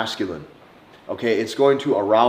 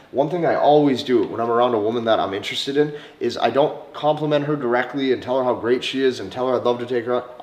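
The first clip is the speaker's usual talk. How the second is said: usual speaking voice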